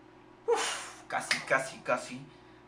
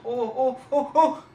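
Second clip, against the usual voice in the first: high, loud repeated ohs; alarmed "oh" cries; 8 semitones higher, 9 dB louder, much slower than usual. Real, not acted. Player watching the ball in danger, alarmed cries. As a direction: cry out in rising alarm.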